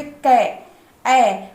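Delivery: कै drawn out, then its ऐ vowel alone; ai ending shown. Shown as a demonstration. Teacher proving the mistake, lingering on the ai in कै. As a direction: stretch कै so the ऐ at its end stands out. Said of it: "कै बोलने पे ऐ की मात्रा आ रही है".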